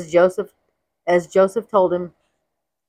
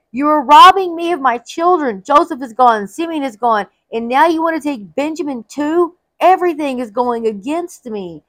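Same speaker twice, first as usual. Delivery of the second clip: exclaiming; 6 semitones higher than usual. Acted. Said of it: "Jacob exclaimed".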